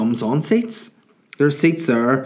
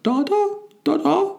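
put-on very high, slow baby voice; baby babble. Baby daughter calling for her dad, high babbling. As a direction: coo it like an infant. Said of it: "what she'll probably just say is".

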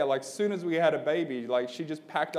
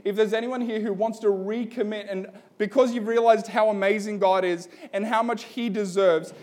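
altar call appeal; 2 semitones higher, 4 dB louder than usual. Real, not acted.

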